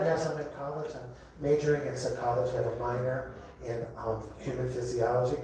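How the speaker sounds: very soft, low and hesitant; demure student. Acted. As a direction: play the quiet, proper college girl. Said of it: "she'd be like".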